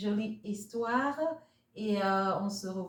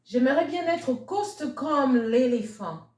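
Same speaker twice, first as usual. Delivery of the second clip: French story reading; 4 dB louder than usual. A narrator's reading. Reading the French version to children, lilting storyteller voice. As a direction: tell the tale to little ones in French.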